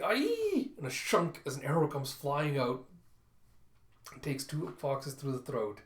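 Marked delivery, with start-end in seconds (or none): 0.0-0.7 very high, loud yelp; piercing cry. A narrator's reading. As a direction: yelp out suddenly mid-battle. Cry as arrow flies.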